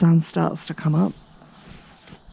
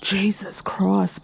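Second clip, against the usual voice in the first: slow breathy whispered exasperation; whispered aside; 6 dB quieter, much slower than usual. Real, not acted. Vlogger recalling mum's crude joke, whispered aside. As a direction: mutter it under your breath, slowly. Aside after mum quote.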